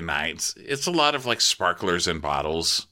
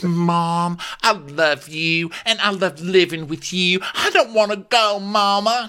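pitched-up clingy daughter; whiny daughter; 10 semitones higher than usual. Retold or imitated. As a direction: beg mama not to send her away.

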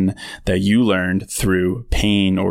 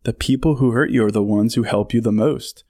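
usual speaking voice

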